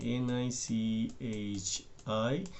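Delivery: letter by letter, very slow and careful; spelled out slowly. Shown as a demonstration. Teacher spelling the word for students, slowly letter by letter. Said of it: "इसको क्या बोलते हैं".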